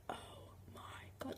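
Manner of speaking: barely audible, spaced-out whisper; terrified whisper. Retold or imitated. Teller hearing taps from the ghost, terrified whisper. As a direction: breathe it out in dread.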